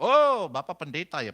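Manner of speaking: loud, slow, drawn-out mock scorn; curt greeting. Husband greeting an unwelcome pastor, curt and loud. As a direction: imitate a hostile husband sizing up a visitor. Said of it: "mau, mau ketus".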